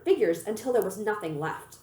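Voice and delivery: plain reading voice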